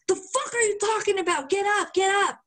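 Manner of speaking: high, scolding mock girlfriend; imitating the girlfriend telling him off; mock angry girlfriend. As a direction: snap at him to get up. Girlfriend voiced in quarrel.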